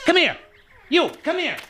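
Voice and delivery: high sharp call; calling back. Acted. Thorne calling the laborer back, high and sharp. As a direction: summon him back at once.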